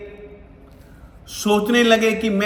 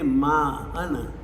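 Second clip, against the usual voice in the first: drawn-out reverent word; 7 semitones lower, 6 dB quieter, much slower than usual. Acted. Drawn-out climax of Mahavir's greatness.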